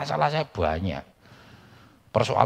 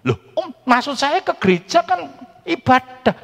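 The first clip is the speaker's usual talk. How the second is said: high, loud put-on old man voice; old uncle's voice; 7 dB louder than usual. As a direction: play the stubborn uncle, pitched way up. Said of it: "uncle's excuse voiced".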